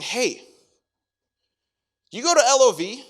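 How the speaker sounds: playful accuser catching him out; accusing friend. Acted. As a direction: pounce on him with the gossip. Acted accuser on social media.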